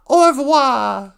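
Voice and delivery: loud, high, drawn-out French flourish; mock French farewell. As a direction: belt out a slow, exaggerated French goodbye.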